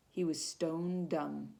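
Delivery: ordinary delivery, nothing marked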